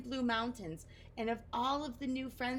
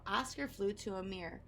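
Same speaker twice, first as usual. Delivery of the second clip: ordinary delivery, nothing marked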